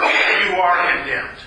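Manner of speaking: ordinary talk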